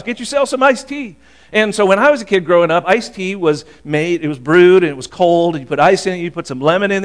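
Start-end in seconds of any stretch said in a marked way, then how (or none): 0.0-1.2 high, welcoming Southern mom urging you to eat; friend's Southern mom. Retold or imitated. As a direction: pitch up high as a hospitable mom offering a plate. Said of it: "Anytime I came to her house".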